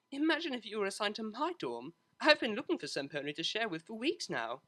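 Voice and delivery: lightly voiced eager young mare; a hint of the cellist's hopeful lift; Octavia's voice. Octavia excited about dorm.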